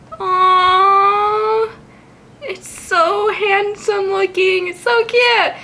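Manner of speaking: high, drawn-out aww of adoration; cooing. Real, not acted. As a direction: melt over how cute it is. Cooing over a cute Pokemon.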